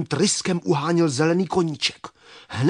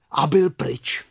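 plain reading voice

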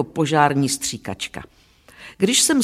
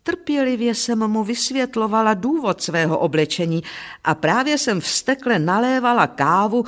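usual speaking voice

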